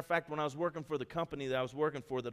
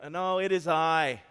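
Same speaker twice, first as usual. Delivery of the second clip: loud, drawn-out call; 2 semitones higher, 9 dB louder, slower than usual. Acted. Jesus answering the frightened disciples from the water, loud slow call. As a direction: call slowly across the water.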